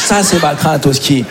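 ordinary delivery

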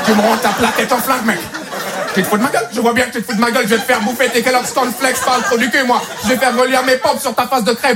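dubbed eighties-film police captain; American police chief. Acted. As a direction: chew out your officers like a movie police chief. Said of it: "cet accent du chef de la police noire américaine". (chef de police américain doublé, énervé)

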